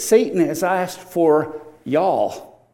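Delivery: slow Southern-tinged "Satan has asked for y'all"; mild Southern drawl quoting scripture; y'all paraphrase. Shown as a demonstration. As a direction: stretch "y'all" out Southern style. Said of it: "But he says".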